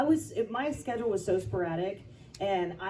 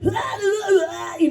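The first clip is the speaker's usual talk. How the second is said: much louder, higher laughing rock wail; rock singer yell; 14 semitones higher, 14 dB louder than usual. Retold or imitated. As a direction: belt it out like a lead singer. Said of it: "I could literally just be like".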